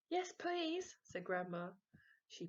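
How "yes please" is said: much higher, keen grandmother; lightly voiced delighted granny; eager granny. Grandma accepting a chocolate, high and eager.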